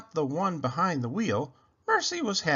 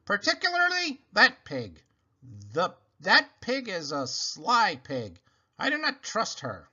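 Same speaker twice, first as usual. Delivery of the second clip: stern disapproving sister; 7 semitones higher than usual. A narrator's reading. Eugenia warning her sister about the pig, prim. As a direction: look down your nose at that pig. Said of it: "says Eugenia".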